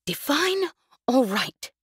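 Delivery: slow, high, breathless gasp. A narrator's reading. Jez, breathless from running, gasping back at Tay. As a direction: get it out between ragged breaths. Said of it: "she gasped".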